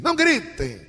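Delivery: high, sharp scold; a light mock yell of a parent; mock parental scold. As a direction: scold the kids without much force. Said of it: "é como dizer para os filhos".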